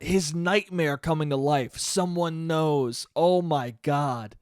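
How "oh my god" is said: ordinary delivery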